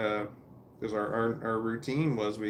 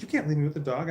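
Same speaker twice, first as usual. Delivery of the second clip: lightly voiced girl, higher pitch; mildly mock-alarmed objection, pitched up; daughter protesting; 5 semitones higher, 4 dB louder, faster than usual.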